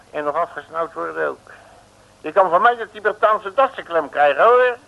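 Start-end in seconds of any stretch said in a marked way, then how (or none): 2.2-4.8 loud, rising indignant retort; angry outburst. Acted. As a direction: tell the guard off. Prisoner snaps at guard.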